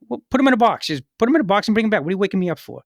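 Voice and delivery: impatient supervisor waved off at night; irritated sleepy boss. Retold or imitated. Woken boss on the phone brushing off the agent, irritated and fast.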